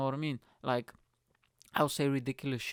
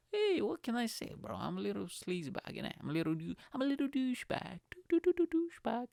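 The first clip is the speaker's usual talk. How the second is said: silly high voice; 9 semitones higher than usual. Pretend. Mock self-description in silly voice.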